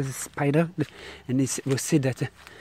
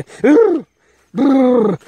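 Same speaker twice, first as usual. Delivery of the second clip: high, loud shuddering brrr; shivering; 11 semitones higher, 13 dB louder than usual. Real, not acted. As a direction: shudder hard with the creeps. Shuddering at the creepy place.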